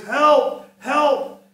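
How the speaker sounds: high, loud calling out; crying out. Retold or imitated. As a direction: call into the dark house. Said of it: "she cried out".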